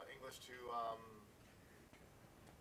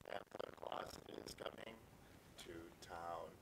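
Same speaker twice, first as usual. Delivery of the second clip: nearly whispered final line of a Christmas song; hushed song ending; 7 semitones lower, 11 dB quieter than usual. Pretend. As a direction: drop suddenly soft, sinking low on "to town". Hushed ending to the performed lyrics.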